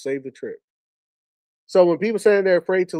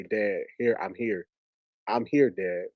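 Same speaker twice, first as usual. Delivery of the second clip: low, soft words to a father; hushed, low imagined bedside reassurance; 7 semitones lower, 6 dB quieter than usual. Retold or imitated. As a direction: gently let Dad know, pitched low and soft.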